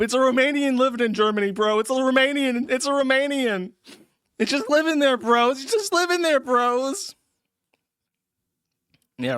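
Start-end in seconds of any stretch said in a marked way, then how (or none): 0.0-7.2 excited ranting. Real, not acted. Mocking chat over Romanian streamer.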